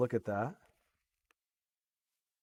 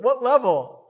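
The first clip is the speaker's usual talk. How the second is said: laughing; 9 semitones higher, 10 dB louder than usual. Real, not acted. Laughing at own old program.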